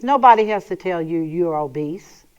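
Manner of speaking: ordinary talk, nothing marked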